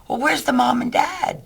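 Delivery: mock worried demand; nervous Doug. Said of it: "nervous like he is".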